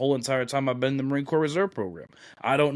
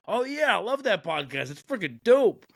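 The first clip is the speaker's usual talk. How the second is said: very high, excited imitation of the brother; enthusiastic background voice; 11 semitones higher than usual. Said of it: "you hear on the background".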